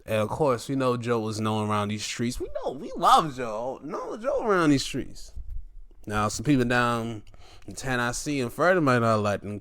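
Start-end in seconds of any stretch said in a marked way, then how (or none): 2.4-5.3 playful insistence. Acted. Playful affirmation of loving Joe.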